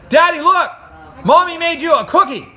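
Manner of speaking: put-on high, loud excited little boy; a child showing his dad a treat, high and excited. Boy calls his father to see the cookie, high and eager. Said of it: "Christopher said".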